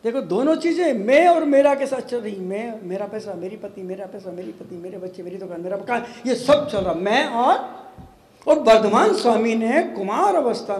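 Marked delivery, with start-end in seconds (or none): 2.4-6.0 low, very soft running list; muttered litany. Acted. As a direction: rattle it off under your breath. Mimics endless "me and mine" litany.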